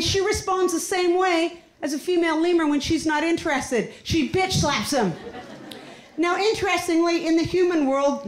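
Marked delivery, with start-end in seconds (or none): none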